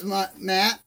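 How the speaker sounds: ordinary delivery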